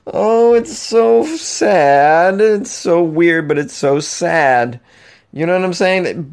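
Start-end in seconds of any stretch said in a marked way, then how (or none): 0.1-4.8 mock lament. Real, not acted.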